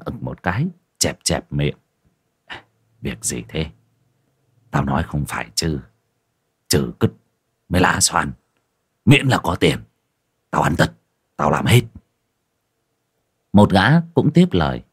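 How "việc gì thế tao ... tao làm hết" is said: a touch lower, easygoing host grumbling over drinks; lightly played tavern keeper complaining about hard times; a hint of a boozy village host; lightly voiced innkeeper. Narrator voices Thịnh again.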